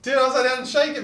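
loud, high-pitched excited instructions; frantic urging. Real, not acted. Friend reading can instructions in a hurry, frantic urging. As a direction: urge him on in a real giddy panic.

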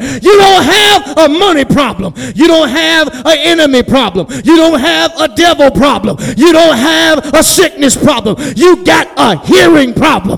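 high, loud, driving preacher shout; full-force preaching. Real, not acted. Preacher urging the congregation to hear, full shouted cry. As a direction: build to a sustained, high, shouted climax.

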